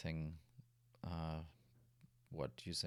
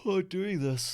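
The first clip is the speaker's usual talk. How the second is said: yawning; 13 semitones higher, 12 dB louder than usual. Real, not acted. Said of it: "I'm yawning again now".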